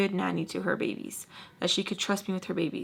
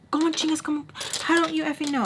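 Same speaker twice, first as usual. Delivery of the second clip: higher, pushy mock yell; yelling demand; 8 semitones higher, faster than usual. Baltimore demanding the nanny say where Sam is, pushy mock yell. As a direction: imitate her snapping at you.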